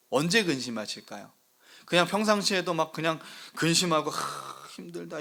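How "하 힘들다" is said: mock weary sigh. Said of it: "이러고 계실까요?".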